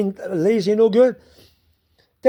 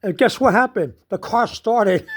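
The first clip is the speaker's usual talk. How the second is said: ordinary talk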